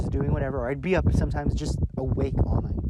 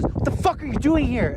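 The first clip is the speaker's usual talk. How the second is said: a raised, lightly voiced uncle demand; half-hearted imitation of a furious uncle; mock angry uncle; 9 semitones higher, 4 dB louder than usual. Uncle catches nephew skipping school, mock angry.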